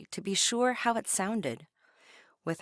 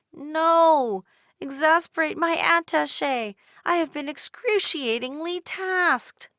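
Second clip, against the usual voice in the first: much higher, loud frantic pleas; frantic girl; 9 semitones higher, 7 dB louder than usual. A narrator's reading. Dolores frantically answering the shopkeeper, higher and louder. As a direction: blurt it out in a rush. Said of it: "a shopkeeper asked".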